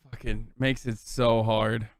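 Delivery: usual speaking voice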